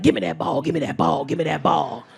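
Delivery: put-on low, insistent kid chant; imitating weird kid. Imitating weird little kid demanding.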